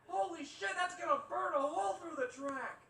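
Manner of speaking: high, loud mock-amazed onlookers; amazed crowd. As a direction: gasp like impressed parents. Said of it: "People were like".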